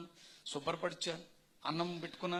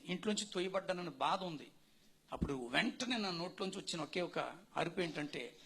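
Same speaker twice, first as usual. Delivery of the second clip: ordinary talk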